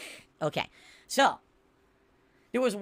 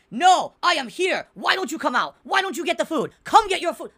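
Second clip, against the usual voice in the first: higher, louder mock yell of an angry driver; imitated all-caps yelling; 8 semitones higher, 9 dB louder, slower than usual. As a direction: shout it like a text typed in caps. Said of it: "He's screaming at me".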